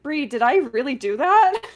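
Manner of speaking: real laughter bubbling through the words; laughing disbelief. Real, not acted. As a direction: ask it while cracking up. Laughing while retelling message.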